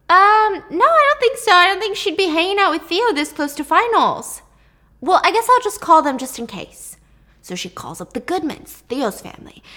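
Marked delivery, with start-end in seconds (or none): 0.1-6.9 much higher, louder anxious mom; worried mom. Retold or imitated. Mom brushes off her husband, higher anxious voice. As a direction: push the worry up high.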